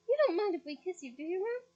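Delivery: high, loud fake worry; falsely anxious. Twin teasing Ron with false worry. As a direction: tease with mock anxiety. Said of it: "in a falsely anxious voice".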